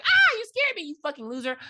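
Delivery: high yelp easing into scolding; mock startled. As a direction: act out a jump-scare, then scold. Acting woman startled by boyfriend.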